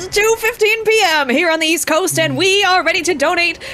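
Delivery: high, bright drive-time radio DJ; nineties radio DJ voice. Acted. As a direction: hype up the listeners like morning radio. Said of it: "nineties disc jockey voice".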